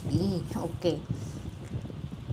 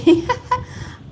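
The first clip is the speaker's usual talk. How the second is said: a sharp, high, loud laugh; very high shriek of laughter; 6 dB louder than usual. Real, not acted. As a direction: squeal with delight. Laughs after greeting Billy.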